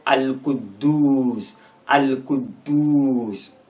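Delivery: "Al-Quddus" slowly with a long held oo; teacher's slow "Al-Quddus", final syllable drawn out; prolonged U. Shown as a demonstration. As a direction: stretch the last vowel of "Al-Quddus" into duuus. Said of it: "that part should be prolonged".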